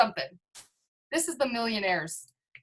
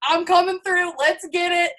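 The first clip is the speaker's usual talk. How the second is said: higher, louder, playful bragging in a put-on voice; cocky hype; 6 semitones higher, 6 dB louder than usual. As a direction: boast to the team like you're already rich. Retelling her own hyped boast.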